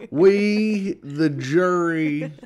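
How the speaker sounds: slow, drawn-out verdict reading; mock jury foreman. Pig jury's foreman delivers the verdict, slowly and haltingly. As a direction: read the verdict with mock solemn pauses.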